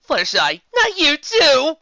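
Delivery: high, loud, drawn-out wail; exasperated cry. Acted. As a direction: cry out in dismay. Twilight exasperated outburst.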